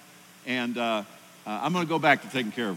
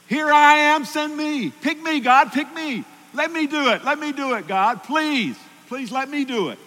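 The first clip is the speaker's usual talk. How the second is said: eager pleading; 13 semitones higher, 10 dB louder than usual. Acted. Isaiah eagerly volunteering to God.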